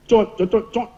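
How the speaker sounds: quick spoken kissing noises; mimicked kisses. Ustaz kissing father-in-law's cheek, imitated smacks. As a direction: peck out a run of mock kisses. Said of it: "saya cium pipi dia".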